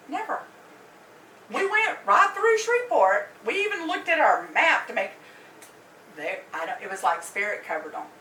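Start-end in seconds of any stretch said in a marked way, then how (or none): 0.1-5.1 high and loud, insisting; emphatic disbelief. Real, not acted. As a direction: swear you never saw a single casino. Insisting they never saw casinos.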